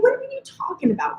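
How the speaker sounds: high, incredulous what are you talking about; shocked question. Retold or imitated. Her shocked reaction.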